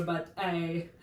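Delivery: normal talking voice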